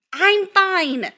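loud, high protest; insistent protest. A narrator's reading. Upset son reassuring his mother, loudly insistent. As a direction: insist far too loudly.

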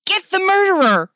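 high, loud rallying cry; hammed-up shout of accusation. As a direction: shout to set the mob on someone. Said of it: "Steph shouted".

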